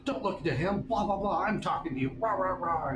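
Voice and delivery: high, mocking rant with a blah-blah; hammed-up high tirade; scolding teacher. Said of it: "She goes".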